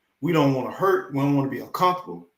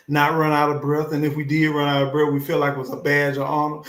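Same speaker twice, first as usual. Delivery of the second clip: ordinary delivery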